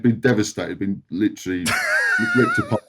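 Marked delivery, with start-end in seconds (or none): 2.0-2.8 laughing. Real, not acted. Laughs telling of dead chickens.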